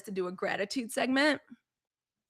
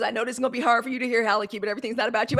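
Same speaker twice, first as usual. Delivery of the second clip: quick therapist quote; much faster than usual. Retold or imitated. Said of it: "She goes".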